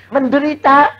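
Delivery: ordinary talk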